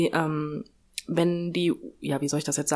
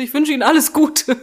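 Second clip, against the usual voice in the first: laughing farewell; 6 semitones higher, 6 dB louder than usual. Retold or imitated.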